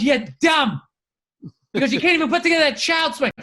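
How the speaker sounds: lightly hammed-up telling-off; mock scolding. Wife berates husband over child's swing, mock scolding. Wife's scolding in retold fight.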